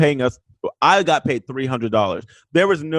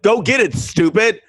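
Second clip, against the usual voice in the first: mock irritated scolding, pitched up; berating; 6 semitones higher than usual. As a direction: imitate someone berating a castmate. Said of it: "Brian berate me".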